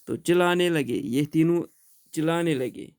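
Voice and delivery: plain reading voice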